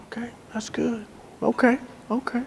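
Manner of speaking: quietly acted patient listener; quiet listening murmur. Dad quietly listening to man.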